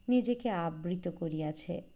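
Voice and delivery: usual speaking voice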